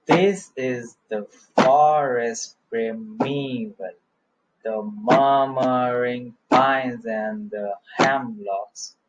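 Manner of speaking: slow verse chant leaning hard on FOREST, MURMURING, BEARDED; dactylic beat. Shown as a demonstration. Teacher showing students the dactyl beat, slow stressed recitation. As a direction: beat out every dactyl, slowly. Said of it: "I'll give you the beat".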